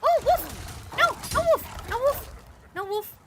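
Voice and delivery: high, panicked cries at a wolf. Real, not acted. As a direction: shriek it like the wolf's right there. Gamer sees a wolf in game.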